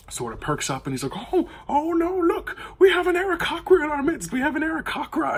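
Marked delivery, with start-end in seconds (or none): 1.3-5.4 very high, loud mock panic, crying out to the crowd; old man shrieking to the market crowd, in hammed-up alarm; screaming alarm. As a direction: shriek it to cause a scene. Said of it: "like, screaming".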